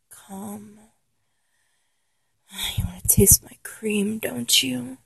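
soft, low, breathy tease; low seductive murmur. Acted. Lover teasing partner, hushed and seductive.

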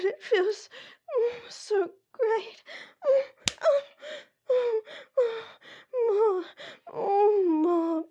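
high, loud pleading cries building; breathless rising moans; climaxing moans. Acted. Escalating moans during spanking.

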